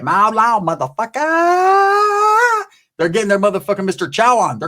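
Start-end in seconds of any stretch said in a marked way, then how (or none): 0.0-2.6 high, very slow drawn-out taunt; hammed-up gloating taunt; mock taunt. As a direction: stretch the insult way up high. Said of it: "These Asians are talking shit".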